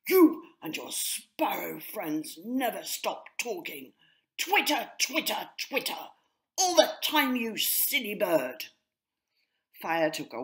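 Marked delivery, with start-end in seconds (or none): 0.1-8.7 louder, rising into high twittering mockery; mocking rant. A narrator's reading. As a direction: sneer at the chatterbox. Po mocks sparrow chatter.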